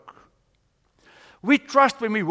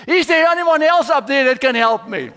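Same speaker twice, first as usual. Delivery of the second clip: high, loud call for somebody else; shouted comic plea; 7 semitones higher, 7 dB louder than usual. Retold or imitated. Hanging man seeks anyone else up there, shouting. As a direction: yell past God for another helper. Hiker shouts past God for others.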